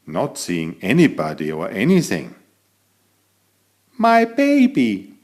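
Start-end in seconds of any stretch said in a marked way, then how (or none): 4.0-5.1 high, slow, tender mother's voice; proud kangaroo mother. Acted. Kangaroo mother telling the cat she has a baby, high and proud. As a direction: say it proudly, like a new mum.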